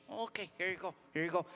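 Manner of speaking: low, soft mumbled handover; comply quietly; subdued, low and meek; meek compliance. Acted. Driver meekly handing over license.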